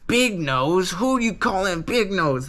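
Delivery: lightly voiced insulted clown snapping back; offended villain. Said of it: "Buggy's like".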